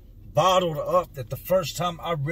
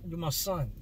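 higher, quick, softer teasing son voice; a dad imitating his future son, higher and fast; grown-up son; 5 semitones higher, 5 dB quieter, faster than usual. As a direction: lightly play the embarrassed teenage son brushing off dad. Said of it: "one day he's gonna be too big".

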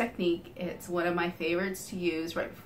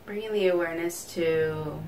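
guided meditation. Real, not acted. Leads a body scan meditation.

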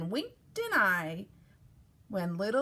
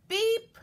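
high, short horn beep; little truck beep; 11 semitones higher, 3 dB louder than usual. A narrator's reading. Blue answers the goat, high beep. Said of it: "Blue said".

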